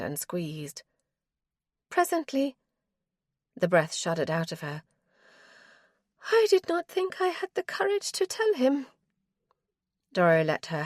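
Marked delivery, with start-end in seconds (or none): 1.9-2.6 higher-voiced wife, cold and weary; wife's voice. Wife's voice again. 6.3-8.9 higher-voiced wife, cold and weary; wife's voice. Wife's voice again.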